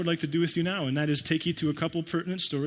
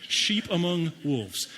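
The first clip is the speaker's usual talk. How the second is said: ordinary talk, nothing marked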